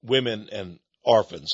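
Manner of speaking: lightly voiced Obama, brushing off the critics; mock Obama. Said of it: "Obama laughed".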